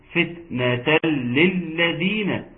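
"فتنة للذين" slow, airy t and held doubled l; slow after whispered T. Shown as a demonstration. Teacher joins the phrase after hams, slow recited "فتنة للذين". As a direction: release the t of "فتنة" with breath, lengthen "للذين". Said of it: "بعد الهمس".

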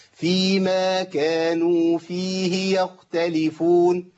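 slow recitation, خ in يختلفون barely heard; dropped kha. Shown as a demonstration. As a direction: chant يختلفون letting the خ vanish. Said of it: "هتسمعوا مني خاء ولا لأ". (تلاوة بطيئة تسقط الخاء)

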